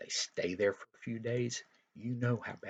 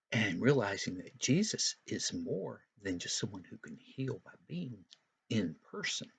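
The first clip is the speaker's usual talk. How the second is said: normal talking voice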